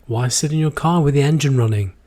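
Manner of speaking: ordinary delivery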